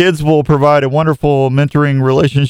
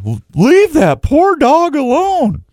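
high, slow, scolding wife voice; mock wife telling him off; imitating his wife; slower than usual. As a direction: play the protective wife, pitched way up. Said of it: "Jenny ever sees that".

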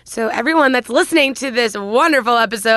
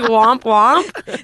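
loud mocking womp-womp; Siggy impression; 5 dB louder than usual. Teasing a co-host about her whoop, loud mock impression. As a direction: exaggerate a friend's catchphrase into a womp. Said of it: "Siggy like".

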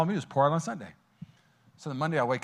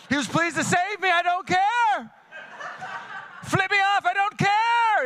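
carefree joy; 3 dB louder, slower than usual. Retold or imitated. Carefree joy while driving.

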